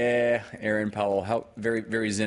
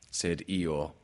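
usual speaking voice

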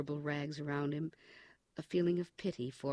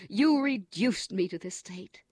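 higher, louder, slow sobbing protest; tearful accusation; 5 semitones higher, 10 dB louder than usual. Acted. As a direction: blame him through tears. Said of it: "sobbed Winston".

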